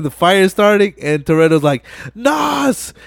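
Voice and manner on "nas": high-pitched, urgent mock shout; acted warning yell. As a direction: yell a retold warning before the blast. Voicing Toretto's warning before explosion.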